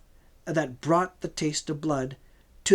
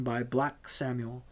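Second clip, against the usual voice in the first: ordinary delivery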